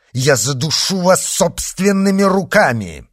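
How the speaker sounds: heated, high-pitched menace; furious threat. A narrator's reading. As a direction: threaten with mounting rage. Furious duke threatens the spy.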